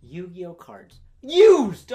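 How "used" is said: high, loud burst on one word; indignant shout. Real, not acted. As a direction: shout it in comic outrage. Indignant outburst about used cards.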